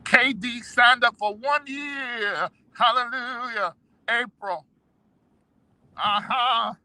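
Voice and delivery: slow, high preacher call; preaching. Acted. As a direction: proclaim it like a sermon. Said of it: "I feel like preaching".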